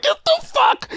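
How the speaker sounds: very high, hammed-up disbelief; incredulous yelp. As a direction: replay your shock in a squeaky-high cry. Retelling his shocked reaction to film.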